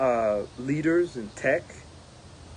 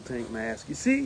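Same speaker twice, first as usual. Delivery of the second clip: ordinary talk, nothing marked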